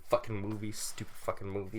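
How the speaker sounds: mock sulky grumble; grumbling. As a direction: sulk at the movie for making you cry. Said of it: "I was like".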